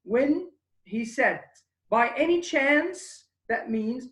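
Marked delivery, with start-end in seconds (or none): 1.9-3.3 By any chance with a high rise at the end; rising question tune. Shown as a demonstration. Teacher modelling the rise of a yes or no question. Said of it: "Notice the rising also intonation".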